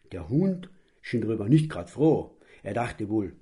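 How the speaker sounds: usual speaking voice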